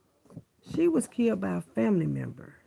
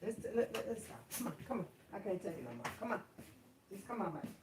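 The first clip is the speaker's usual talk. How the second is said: soft coaxing; 13 dB quieter, faster than usual. Real, not acted.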